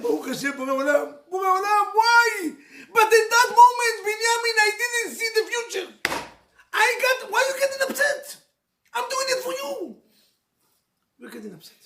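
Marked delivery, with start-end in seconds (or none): 0.0-2.3 loud, high cry to God; anguished outcry. Retold or imitated. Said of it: "He said". 3.0-10.0 much higher, loud crying shout; tearful shouting. Real, not acted. Preacher erupts with tearful shouting.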